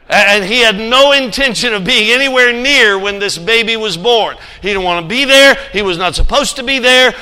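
loud, rising sermon build; preaching at full force. Acted. Preacher telling the congregation about the stable, loud and urgent. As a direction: drive the story home from the pulpit.

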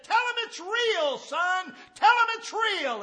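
a dying man sitting up to plead; dying father's words. Retold or imitated.

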